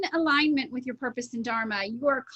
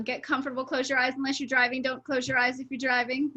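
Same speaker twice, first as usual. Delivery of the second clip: usual speaking voice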